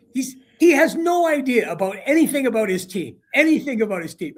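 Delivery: high, emphatic imitation of ranting; retold exasperated rant, hammering the point; mad. Mad colleague ranting to wife about broadcaster, exasperated. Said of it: "And he's mad now".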